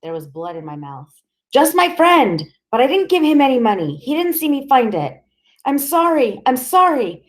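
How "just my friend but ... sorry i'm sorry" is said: high, loud and rushed; frantic pleading. Acted. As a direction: babble in terror. Boy sobbing under interrogation.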